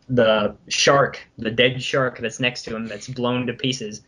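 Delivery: normal talking voice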